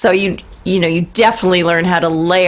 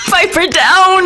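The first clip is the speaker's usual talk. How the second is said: laughing; 12 semitones higher, 3 dB louder, slower than usual. Real, not acted. Laughing at the bagpipe joke.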